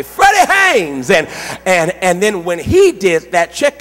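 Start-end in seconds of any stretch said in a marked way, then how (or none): 0.0-1.1 high, stretched-out imitated holler. Stranger hails the visiting pastor in hospital, imitated holler. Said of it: "they hollered at me".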